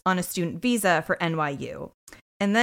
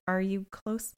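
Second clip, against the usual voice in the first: gentle snark; 9 dB quieter, slower than usual. Retold or imitated. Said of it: "Olivia says gently, but with a tad of snark".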